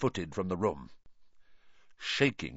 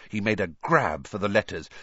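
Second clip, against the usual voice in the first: plain reading voice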